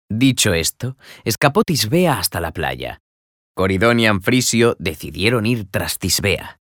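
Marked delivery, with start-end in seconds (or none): none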